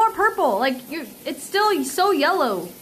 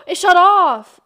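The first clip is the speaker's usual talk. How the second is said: sharp gasp, then slow, loud alarm; surprised gasp; 9 dB louder, slower than usual. Real, not acted. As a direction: caught off guard, gasp and blurt it. Scale suddenly dies mid-measure.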